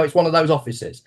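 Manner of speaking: normal talking voice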